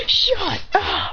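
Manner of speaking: high-pitched, drawn-out mock yell; annoyed outburst.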